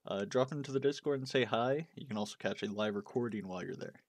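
loud upbeat ad read. Acted. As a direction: sell the podcast ad. Reads podcast ad.

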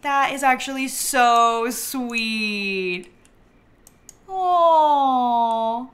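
slow gushing with a long, loud aww; drawn-out, heartfelt cooing; touched. Real, not acted. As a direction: melt over something sweet. Moved by sweet game moment.

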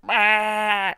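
a loud, high "Bah" like a crazy goat; goat bleat impression. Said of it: "those crazy goats ... They're like".

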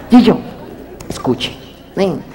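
normal talking voice

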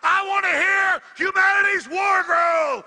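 loud high-pitched shouted war cry; paranoid late night rant. Real, not acted. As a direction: roar it at the enemy.

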